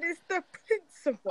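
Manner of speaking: high, mocking girly voice; mimicking girlfriend. Friend mimics the girlfriend's line in a high mocking voice. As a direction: parody her prim insistence. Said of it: "she said".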